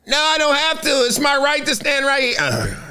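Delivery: high-pitched, put-on indignant refusal; defiant heckler. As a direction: play a stubborn fan standing his ground. Acting out the defiant heckler's reply.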